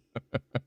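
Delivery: brief, soft, high chuckle; short giggle. Real, not acted. Streamer telling a chatter it was just kidding, short giggle. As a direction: let a quiet little giggle trail out.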